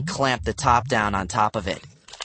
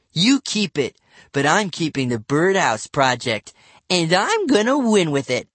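put-on defiant kid; 6 semitones higher than usual. A narrator's reading.

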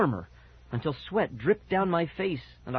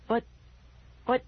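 halting, broken protest; sputtering; 10 semitones higher than usual. A narrator's reading. Boy lost for words before the voice, sputtering. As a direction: stammer with a gap between tries. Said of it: "I sputtered".

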